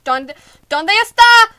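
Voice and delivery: slow, high, loud call; panicked shout. Real, not acted. Player calling out for Chica in panic. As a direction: cry out searching.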